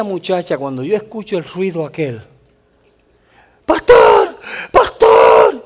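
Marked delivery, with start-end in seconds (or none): 3.7-5.6 high, loud mock cry for help; a retold shout of a frightened girl calling for the pastor; imitated desperate yell. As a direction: call out like the girl being dragged away. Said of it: "Y ella gritaba".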